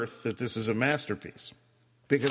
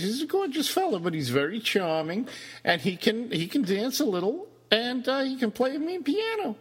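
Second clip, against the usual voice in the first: much higher, gushing praise; 11 semitones higher than usual. Real, not acted. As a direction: build up the praise, then drop the punchline. Gushing then teasing about actor.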